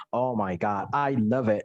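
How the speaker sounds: "I love it" with a native lax vowel, deep and drawn; teacher showing the native way to say it; native English sound. Shown as a demonstration. Teacher modelling the real English sound to students. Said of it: "pakai suara aslinya".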